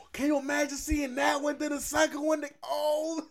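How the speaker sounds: high-pitched, thrilled wonder; real excitement. Real, not acted. Fan imagining seeing both films, excited wonder.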